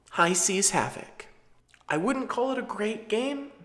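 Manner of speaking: effeminate voice. Real, not acted. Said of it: "I'm practicing my more effeminate voice".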